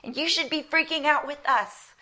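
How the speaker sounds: high-pitched, hammed-up exasperation; frustrated. Disciples to Jesus asleep in the boat, frustrated. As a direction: act out indignant complaining at a sleeper. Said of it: "You can hear the frustration".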